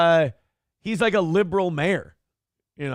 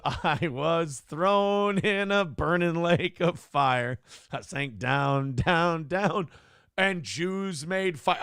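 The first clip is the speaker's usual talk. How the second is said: slow dramatic reading; much slower than usual. Acted. Reading donor message dramatically.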